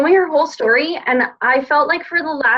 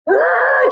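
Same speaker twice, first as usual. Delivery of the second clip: high, loud imitated crying wail; retold sobbing wail; 13 semitones higher, 3 dB louder than usual. Retelling crying on the drive home, high loud mock wail. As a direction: wail it like bawling in the car. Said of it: "just crying".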